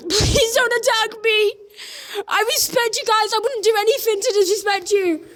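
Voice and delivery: tearful, terrified pleading; real panic breaking into sobs; scared and shaking. Real, not acted. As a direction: beg for mercy, nearly crying. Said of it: "Oh my God, I'm shaking!".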